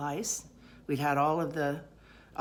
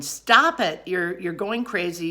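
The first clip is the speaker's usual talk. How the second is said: loud, high yell to stop; raised voice, trying to halt a frenzy; acted scolding; 4 semitones higher, 8 dB louder, slower than usual. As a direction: imitate an exasperated husband giving chase. Chasing me around going.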